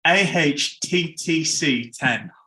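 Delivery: slow, spelled out letter by letter; slow careful retake. Shown as a demonstration. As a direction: carefully say each letter slowly. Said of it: "I'll do it again".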